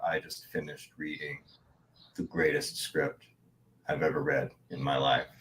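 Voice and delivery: low, hushed, self-important murmur; Steven Seagal. Retold or imitated. Star to waiting visitor about a script, low self-important voice. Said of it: "Steven Seagal appears and he goes".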